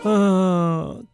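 usual speaking voice

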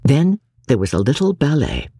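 ordinary delivery, nothing marked

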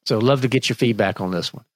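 usual speaking voice

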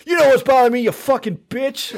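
mock yelling patient. Mocking the patient's reply.

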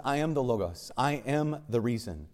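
hushed, measured solemnity; God's solemn declaration. Retold or imitated. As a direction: declare it quietly, one truth at a time. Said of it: "That God says".

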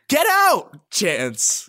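mock shout. Throwing him out.

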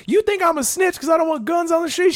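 high-pitched mock indignation; high, indignant imitation of a yelling woman; imitated yelling. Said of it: "yelling with me".

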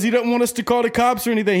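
put-on fast, high-pitched Boston accent; Boston bouncer. Bouncer telling the bar about the victim, Boston accent. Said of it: "it's so Boston".

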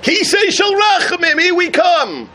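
God answering the shofar, high and exultant; joyful Hashem. Acted. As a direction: announce mercy with joy. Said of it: "and Hashem says".

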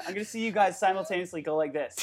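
normal talking voice

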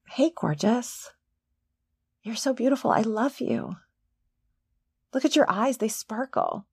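soft loving mirror talk; tender. Pretend. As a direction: coo it gently to yourself.

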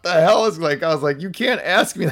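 high, retold incredulous protest with a laugh; incredulous outrage. Answering the boss who cornered them, incredulous outrage.

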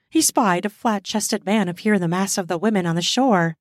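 ordinary delivery

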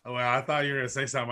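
ordinary delivery

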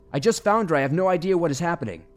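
high, loud shout over rotors; yelling back. Acted. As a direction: yell to be heard over the noise. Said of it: "I yelled back, trying to be heard over the rotors".

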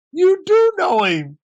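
high, astonished mock teammate voice; amazed teammate. Fatty amazed, retold.

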